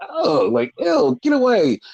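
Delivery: high-pitched grossed-out recoil; an exaggerated "ew" of revulsion; disgusted. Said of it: "They just feel just so disgusting".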